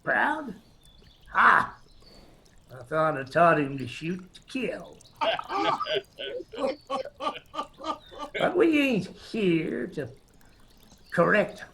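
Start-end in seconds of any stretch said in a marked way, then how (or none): 0.0-1.7 mock disbelief, then a barked ha; scornful scoff. Gang leader sneering at the wounded soldier, scornful scoff. 5.2-8.3 a sharp high cackle; high cackling laugh. Acted. Gang leader laughing over his nephew's aim, cackling.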